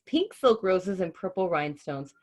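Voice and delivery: usual speaking voice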